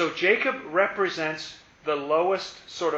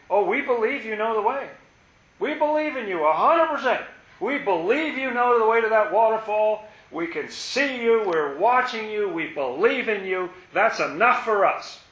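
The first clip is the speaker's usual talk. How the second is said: higher, eager voice of complacent followers; sweetly smug bench-sitters, pitched up; naive believers; 5 semitones higher, 4 dB louder than usual. Acted. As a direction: gush faith while staying seated. Voicing naive followers to guide.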